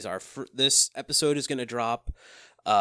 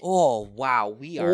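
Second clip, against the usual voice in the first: put-on Midwest mom wonder. Co-host marvels at the network, Midwest mom accent. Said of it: "Except for the Midwest mom".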